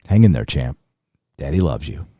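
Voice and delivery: put-on deep tender dad easing a sick child; sick kid dad voice. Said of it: "My kid is sick," dad voice".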